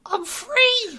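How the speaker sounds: very high, loud squeal; Gax crying out freedom. Acted. Gax getting loose from Spintles, high triumphant cry.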